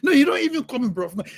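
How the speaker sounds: ordinary talk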